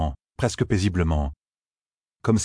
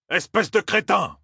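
higher, slow, furious insult; exploding in anger; 13 semitones higher than usual. A narrator's reading. Officer to Rand, exploding with slow fury. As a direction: spit the insult out slowly. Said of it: "explosa l'officier".

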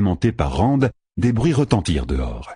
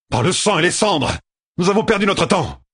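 much higher, exasperated cry; exasperated outburst; 11 semitones higher than usual. A narrator's reading. Rand giving up on the negotiation with Egwene, exasperated outburst. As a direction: throw up your hands in disgust. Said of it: "Il leva les bras au ciel".

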